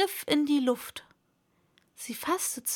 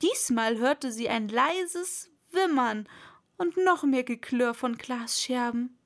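ordinary delivery

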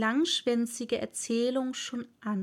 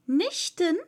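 calling out; 6 semitones higher, 4 dB louder than usual. A narrator's reading. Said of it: "rief Alice".

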